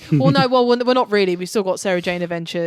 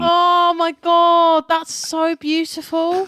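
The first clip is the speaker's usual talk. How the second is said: moved gasp; 9 semitones higher, 4 dB louder, slower than usual. Real, not acted. Moved by a touching reading.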